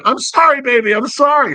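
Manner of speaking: high-pitched tearful pleading, hammed up; high, pleading mock cry; mock crying apology. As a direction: imitate a man whining sorry. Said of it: "And he's crying".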